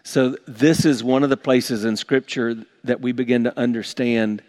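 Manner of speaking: normal talking voice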